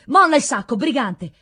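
loud, high order to a robber; forceful confrontation, starting loud; angry command. A narrator's reading. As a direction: shout it as a sharp challenge. Rescuer confronts a thieving knight.

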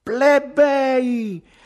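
high, loud, all-out yell; desperate shouting. A narrator's reading. Ugo addresses the plebs after catching breath, desperate shouting. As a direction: cry it out to the masses. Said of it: "gridò alla disperata".

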